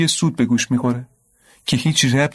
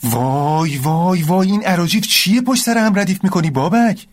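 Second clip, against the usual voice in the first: exasperated outburst; 6 semitones higher than usual. Acted. Amir scolds Babak's nonsense.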